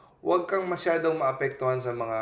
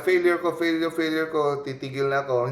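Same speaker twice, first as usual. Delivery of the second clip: louder, higher mock self-pity; discouraged complaint; 5 semitones higher, 5 dB louder than usual. Preacher voicing a discouraged believer, mock self-pity, louder. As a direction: wail it like someone ready to quit. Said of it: "instead na ma-discourage ka".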